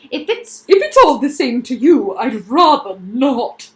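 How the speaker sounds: loud, pompous but backing off; nervous humbug. Acted. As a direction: decline while edging away.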